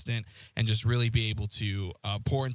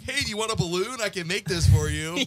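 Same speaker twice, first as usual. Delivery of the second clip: high, singsong pushy vendor voice; mock balloon guy; 8 semitones higher, 5 dB louder than usual. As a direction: sweetly pester the table.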